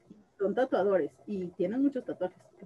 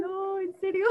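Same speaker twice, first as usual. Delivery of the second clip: teary proud mom; 8 semitones higher than usual. Retold or imitated. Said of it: "así con la lágrima de mamá orgullosa".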